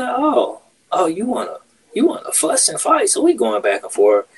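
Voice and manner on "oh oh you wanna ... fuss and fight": much higher, taunting singsong; mocking challenge. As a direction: taunt him, voice jumping way up. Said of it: "I said".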